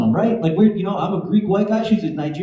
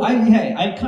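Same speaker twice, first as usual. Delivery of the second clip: ordinary talk, nothing marked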